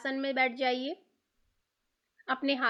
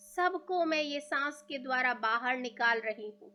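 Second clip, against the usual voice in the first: guided meditation. Real, not acted. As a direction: ease them into stillness, leaving room to breathe.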